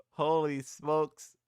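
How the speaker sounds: very slow, drawn-out "Holy smokes"; amused amazement. Real, not acted. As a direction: stretch it out, tickled. Amazed by a meme.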